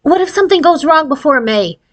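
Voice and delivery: put-on a girl fretting that it might go wrong; lightly voiced worried girl; young Gretchen, worried.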